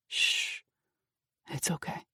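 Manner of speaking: soft, low reassurance; calm her gently; quiet soothing shush; soothing hush. Acted. Soothing shaking girl.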